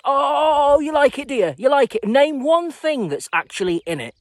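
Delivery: high, loud mock disbelief; loud, high-pitched sarcastic needling; mocking challenge. Mocking those who claim liking it.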